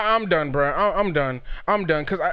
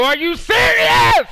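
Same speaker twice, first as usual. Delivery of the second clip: high, loud disbelieving yell; outraged shout; 13 semitones higher, 4 dB louder than usual. Real, not acted. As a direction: explode at the screen. Outraged at opponent's play.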